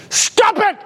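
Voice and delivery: sudden comic outburst of yelling. Retold or imitated. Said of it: "he starts yelling at her".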